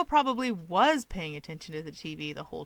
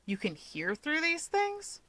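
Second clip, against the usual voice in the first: surprised; 8 semitones higher, slower than usual. A narrator's reading. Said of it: "what comes out is a surprised".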